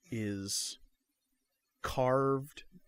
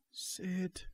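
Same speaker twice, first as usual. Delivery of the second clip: high, frail call down the hall; a hint of a weak old mother; 10 semitones higher than usual. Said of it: "your mother's weak voice".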